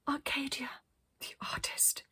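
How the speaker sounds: soft whispered self-naming; awed whisper. Acted. Arcadia names herself to the children, whispered. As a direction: hush it reverently.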